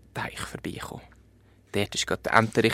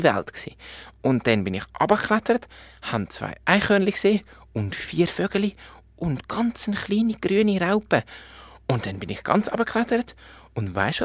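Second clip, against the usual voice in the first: put-on high, eager child-bear voice, louder; a little cub bursting to tell mum news; excited little bear; 10 semitones higher, 6 dB louder than usual. Little bear tells his adventure. (aufgeregter kleiner Bär, hoch und laut)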